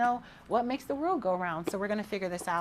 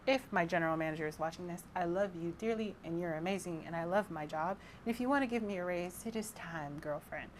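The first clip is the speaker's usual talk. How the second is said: much softer, quick aside; quiet aside; 9 dB quieter than usual. Real, not acted. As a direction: drop the volume for a side message to the boss. Soft aside to her manager.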